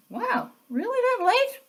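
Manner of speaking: sudden high, startled wow at the date; genuinely taken aback, voice jumping up; surprised. Real, not acted. Host checking her notes, surprised at the year.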